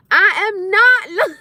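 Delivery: high, slow, emphatic protest; loud denial. Real, not acted. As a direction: belt out the denial, stretching each word. Loudly denies being loud.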